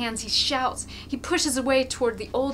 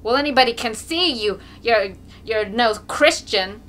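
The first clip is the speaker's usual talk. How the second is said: loud reproach; 2 semitones higher, 8 dB louder than usual. A narrator's reading. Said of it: "a hubbub of voices".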